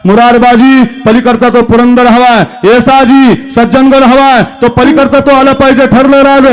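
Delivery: high, ringing roll-call delivery; fiery kirtan oratory. Acted. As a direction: call out each name like a war leader rousing troops.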